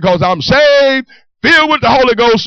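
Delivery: acting out a zealous new convert; fervent declaration. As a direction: proclaim it proudly, stretching the key word. Retold proud declaration of faith.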